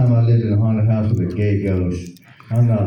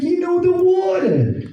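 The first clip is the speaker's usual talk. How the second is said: high, hammed-up Brooklyn Puerto Rican accent; slower than usual. Sweaty Brooklyn coworker begging for water, high mock accent. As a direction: squeal it out like a thirsty New Yorker. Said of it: "He's talking that way".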